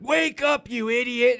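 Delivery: high, loud mock yell at the dummy. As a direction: shout at the dummy like an angry guard, hammed up. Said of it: "He's like".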